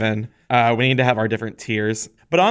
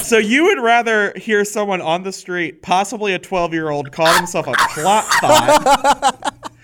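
laughing incredulously; can barely get it out for laughing; 7 semitones higher than usual. Real, not acted.

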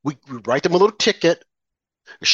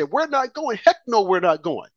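defiant protester; 5 semitones higher than usual. Retold or imitated. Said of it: "go outside and say".

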